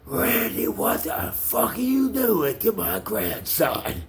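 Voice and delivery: high, slow, horrified grandfather's cry; shocked grandfather. Retold or imitated. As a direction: voice the stunned old man catching him. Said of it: "And then he goes".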